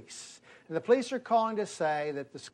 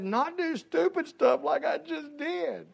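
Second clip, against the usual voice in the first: put-on high, slow kid's answer with a laugh; little boy voice; 12 semitones higher, slower than usual. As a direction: answer sheepishly like a small boy.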